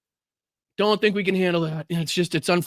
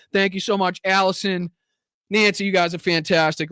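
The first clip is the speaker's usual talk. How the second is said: ordinary talk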